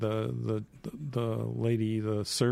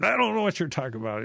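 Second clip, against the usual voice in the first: high, fast protest; acted flustered, insistent denial; stronger denial; 8 semitones higher, 4 dB louder, much faster than usual. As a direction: rattle off an indignant denial. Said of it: "Peter even gets stronger every time".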